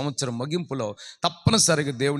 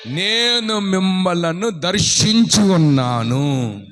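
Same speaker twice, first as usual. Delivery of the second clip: slow, drawn-out announcement; solemn declaration; 7 dB louder, much slower than usual. Acted. Preacher speaking God's words, slow. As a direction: declare it slowly.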